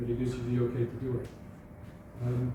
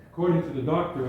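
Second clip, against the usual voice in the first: usual speaking voice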